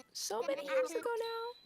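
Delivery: high, soft, exaggerated aside; squeaky self-mockery. Real, not acted. Streamer joking about her age in a squeaky voice. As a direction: squeak it out in mock dismay.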